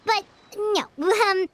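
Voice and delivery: high, flustered denial with an awkward laugh; nervous cover-up. Acted. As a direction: stammer and laugh it off, pitched high. Caught out, denies plotting with nervous laugh.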